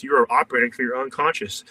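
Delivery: ordinary delivery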